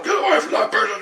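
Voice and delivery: high-pitched yell of an ambulance driver; put-on high yell; mock yelling. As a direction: imitate a bossy paramedic yelling someone away. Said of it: "ambulance driver's yelling at me".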